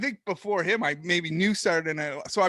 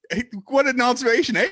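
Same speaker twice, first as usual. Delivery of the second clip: mock Canadian; 5 semitones higher, 7 dB louder, faster than usual. Mocking his own Canadian accent.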